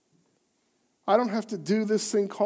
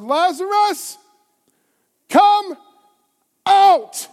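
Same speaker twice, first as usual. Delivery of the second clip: huge ringing shout, much higher and louder; 11 semitones higher, 11 dB louder, much slower than usual. Acted. Jesus shouting into the tomb to dead Lazarus, a huge call. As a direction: call him out of the tomb at full voice. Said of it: "Then Jesus shouted".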